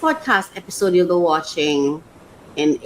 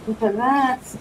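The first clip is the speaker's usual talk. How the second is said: usual speaking voice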